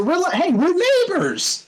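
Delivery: sudden high, delighted exclamation; a burst of glee at the news; excited. Real, not acted. Gamer learns a friend lives next door, squeals excitedly. Learns they live close by.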